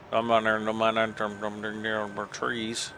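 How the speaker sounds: a hint of a mumbled country farmer accent. Gamer slips into his farmer accent while driving. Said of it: "that's my farmer voice".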